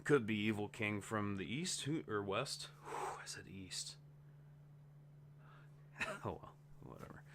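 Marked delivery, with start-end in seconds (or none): 2.8-7.2 whispered aside. Real, not acted. Mutters aside after slipping up.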